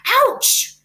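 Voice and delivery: high, loud pig squeal of pain; scream. A narrator's reading. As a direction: yelp as if bitten. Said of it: "he screamed".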